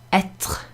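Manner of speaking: "Être" with a throaty, voiceless tr ending; throaty French R. Shown as a demonstration. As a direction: let the end of "Être" rasp in the throat after the T. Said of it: "sometimes actually it will sound like that".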